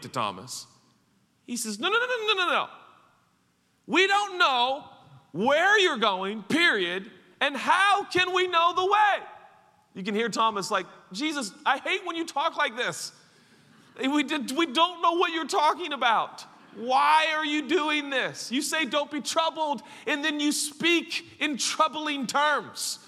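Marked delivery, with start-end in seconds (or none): 1.8-9.3 high, emphatic objection; protesting disciple. Acted. Voices Thomas protesting to Jesus. 11.1-23.0 high-pitched, worked-up protest; exasperated complaint. Acted. Voices Thomas venting at Jesus.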